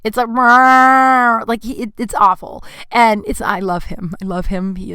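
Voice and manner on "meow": loud, drawn-out imitated yowl; high wailing meow held long; intense cat meow. Imitating own cat's awful meow, intense. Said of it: "His meow is pretty intense".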